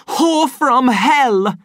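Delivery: slow, loud, high raging yell; furious shout. A narrator's reading. Savage raging at Lenina, shouting slowly. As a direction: drag out every word in rage. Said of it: "he shouted".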